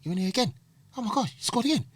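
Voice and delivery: high, amazed mock voice; excited manager. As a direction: imitate the gushing manager. Voicing manager reading newspaper.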